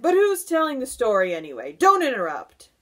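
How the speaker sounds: loud, high, quick protest; indignant cry. A narrator's reading. Centipede scolding friends for interrupting, loud cry. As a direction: cut them off loudly and briskly. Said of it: "the centipede cried".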